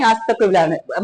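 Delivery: normal talking voice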